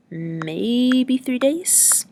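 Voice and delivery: "Maybe" drawn out slowly, rising high into "three days?"; questioning, unsure. A narrator's reading. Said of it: "You say that like it's a question".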